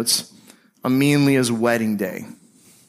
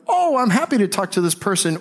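bright, higher happy reply; cheerful reaction; 8 semitones higher than usual. Retold or imitated. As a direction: sound pleased to hear from them. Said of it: "you're either like".

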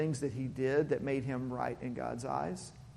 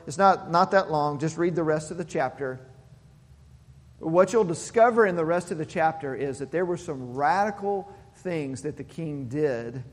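ordinary talk, nothing marked

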